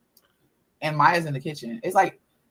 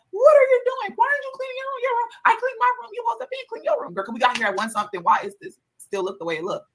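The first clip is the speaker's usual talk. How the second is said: very high, put-on scolding voice; imitating the kid yelling at Maya; mock yelling sister. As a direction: scold like a shrill big sister. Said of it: "She went there yelling at Maya".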